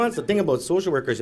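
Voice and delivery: ordinary talk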